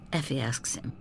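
ordinary delivery

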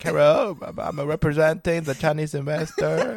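hammed-up Chinese accent, high and loud; bad Chinese-accented investor on the phone. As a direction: put on a mock Chinese accent. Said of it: "put on my best Chinese accent".

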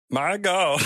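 goofy high-pitched holler; hammed-up go-to creature voice. Shown as a demonstration. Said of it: "my go-to would be like".

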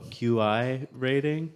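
normal talking voice

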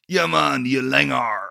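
put-on playful Jamaican accent mixed with Cork slang. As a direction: imitate a Jamaican reggae singer using Cork slang. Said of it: "I can imagine [name] going".

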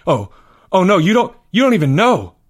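high, loud, stumbling alarm; dismayed realization. A narrator's reading. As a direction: blurt it in rising dismay. Leo realizes they know nothing.

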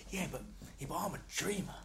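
lightly imitated Cockney, pitch lifting on the punchline; a hint of wistful old-school Cockney; Cockney old fan. Old Millwall fan confiding his hopes, light Cockney imitation. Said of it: "with a good Cockney who did Cockney right".

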